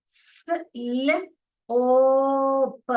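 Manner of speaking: स, ल, ओ, प one by one with ओ held long; phonics sounding-out. Shown as a demonstration. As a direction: separate each sound and stretch ओ. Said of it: "मैंने यहाँ पे ओ क्यों पढ़ा". (स, ल, ओ, प अलग-अलग, ओ लंबा)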